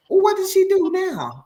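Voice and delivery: high, curious fan asking; eager viewer voice. Retold or imitated. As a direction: play a nosy fan wanting news.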